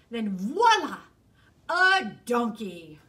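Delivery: loud voila, slowed; showman's loud voila; grand reveal. Acted. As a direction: unveil it with a flourish. Fox's grand reveal again.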